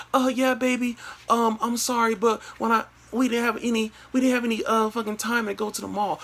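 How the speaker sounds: put-on high falsetto girlfriend voice; imitated girlfriend. Girlfriend apologizing to boyfriend, falsetto imitation. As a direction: mimic her in falsetto, stumbling through excuses.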